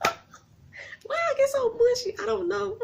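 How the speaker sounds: high, teary voice breaking; getting mushy, near tears; real tearful gush over the show; choked up. Real, not acted. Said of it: "this show just warms my heart".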